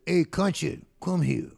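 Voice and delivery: loud hearty call across the room. Retold or imitated. Club boss calling the young guitarist over, loud hearty holler. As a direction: holler it like a boss summoning his boy.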